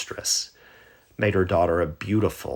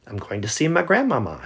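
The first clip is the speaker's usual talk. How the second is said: put-on higher, quick child's voice; hurried, eager little girl; 7 semitones higher, 5 dB louder, faster than usual. Girl answering the woodman, higher and hurried.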